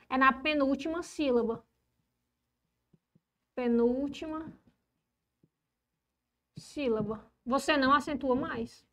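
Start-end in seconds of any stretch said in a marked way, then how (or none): 3.5-7.2 slow, with a long pause between words; subdued, spaced-out dictation. Real, not acted. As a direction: dictate it as you write. Dictates while writing.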